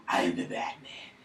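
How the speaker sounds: low, slow whispered hero voice; Batman whisper. Pretend. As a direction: rasp it out like a brooding vigilante. Batman voice, then repeated.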